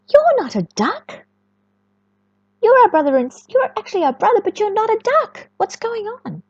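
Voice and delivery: high, bright sibling ducks puzzled; high-pitched little ducks, confused; duckling siblings. Acted. Siblings voiced in story.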